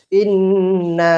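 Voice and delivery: ইন্না said slowly, final vowel held long; long madd. Shown as a demonstration. Teacher drilling learners on pronunciation, slow drawn-out ইন্না. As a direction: stretch both the nasal "nn" and the closing "aa" of ইন্না. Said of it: "উচ্চারণ করি".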